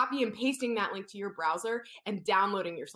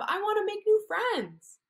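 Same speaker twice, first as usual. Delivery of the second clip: put-on baby shark voice; 11 semitones higher, 4 dB louder than usual. Shark greets crab.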